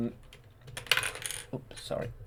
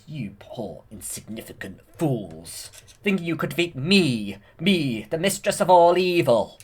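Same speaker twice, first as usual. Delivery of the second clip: villain gloat; 4 semitones higher, 6 dB louder than usual. Retold or imitated. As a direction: gloat like the mistress of all evil, rising. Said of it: "she says".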